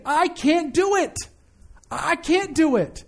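high, slow, drawn-out admission; preacher voicing a plea of surrender; emphatic confession. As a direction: stretch each word, pitched high, admitting helplessness.

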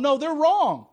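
loud, high mock protest; indignant rejection. People rejecting their pastors, mock indignant.

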